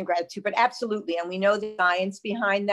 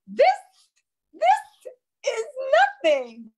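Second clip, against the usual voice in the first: lightly voiced old lady, emotional; 4 dB louder, slower than usual. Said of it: "And then she said".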